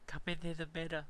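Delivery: a lightly put-on imitation; Geto impression. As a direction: mimic Geto calling players in. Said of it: "It's my Geto impression".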